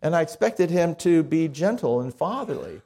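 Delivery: ordinary talk, nothing marked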